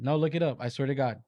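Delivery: usual speaking voice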